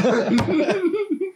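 high-pitched burst of laughing; real laughter. Real, not acted. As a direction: break into high, helpless laughter. Cracks up over the recording.

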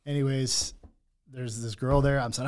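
ordinary delivery

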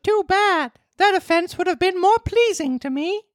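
put-on high puppet boy voice; Pinocchio voice. Pinocchio's line in story.

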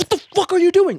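high mock angry shout; retold aggressive yell. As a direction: bark it like a crazy guy on the bus. Retold crazy man yelling.

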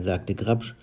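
usual speaking voice

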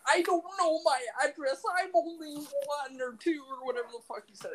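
put-on very high, tiny child's voice; little kid voice. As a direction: squeak it out like a toddler. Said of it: "He's like".

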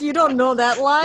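mock smug Shrek. Mocking Shrek who expects every line to be famous, indignant. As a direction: play the offended star, pushing.

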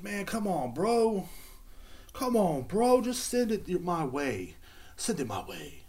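high, whining plea; whiny high pleading. Real, not acted. Pleading with the old employer, high and whining.

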